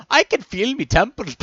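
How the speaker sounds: high, loud mock-Irish voice; joking Irish accent, breaking into laughter. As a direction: put on a hammed-up Irish accent. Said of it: "I had to do an Irish accent".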